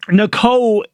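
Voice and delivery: ordinary talk, nothing marked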